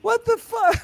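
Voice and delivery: very high-pitched, astonished squawk; incredulous outburst. Real, not acted. Host ribbing guest living near his mom, incredulous outburst. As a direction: pitch leaping way up in real disbelief.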